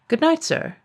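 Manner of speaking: high, cheeky goodbye. Acted. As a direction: sign off sweetly. Cheeky farewell.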